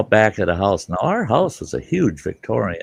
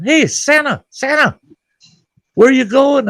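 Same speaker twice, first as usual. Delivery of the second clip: high loud yell across the street; 7 dB louder than usual. Retold or imitated. Neighbor across the street yelling to Santa, loud and high. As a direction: holler after Santa. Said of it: "someone's yelling".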